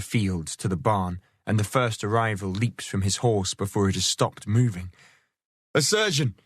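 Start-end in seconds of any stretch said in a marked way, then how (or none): 5.7-6.4 urgent high call for a surgeon; crying out. Acted. Man-at-arms calling for help for his lord, high cry. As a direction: raise the alarm up high. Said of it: "he cries".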